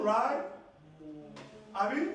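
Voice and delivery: usual speaking voice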